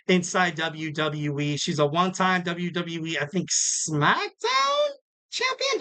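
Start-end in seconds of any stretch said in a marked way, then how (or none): 3.5-5.8 high-pitched, drawn-out questioning; much higher, slow, tentative question; unsure. Real, not acted. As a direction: squeak it out like an uncertain guess. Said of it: "Don't quote me on that".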